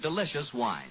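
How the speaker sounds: ordinary talk, nothing marked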